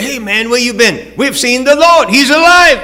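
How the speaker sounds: bursting to tell Thomas the Lord lives; excited disciples. Retold or imitated. Disciples bursting to tell Thomas, rising excitement.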